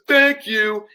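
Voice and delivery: cheeky passer-by; leering thanks. Retold or imitated. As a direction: stranger ogling the gardener. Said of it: "He goes".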